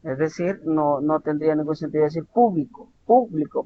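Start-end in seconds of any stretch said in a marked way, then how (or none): 2.2-3.6 "público" with the weight on PÚ, falling after; stress on first syllable. Shown as a demonstration. Teacher showing the tonic syllable, first syllable of "público" stressed. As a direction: hit PÚ in each "público". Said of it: "tenemos la sílaba tónica al inicio".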